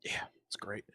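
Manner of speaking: breathy whispered aside. Real, not acted. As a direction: whisper it under your breath. Whispered aside, then said plainly.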